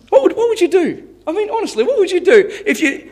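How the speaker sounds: high-pitched, baffled appeal to listeners; real bewilderment, pitched high; incredulous. Real, not acted. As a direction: ask it in amused disbelief, voice shooting up. Amused disbelief over talking donkey.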